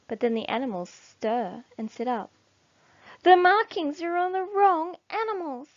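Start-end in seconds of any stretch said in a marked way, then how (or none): 3.2-5.7 alarm. A narrator's reading. Animals waking in alarm.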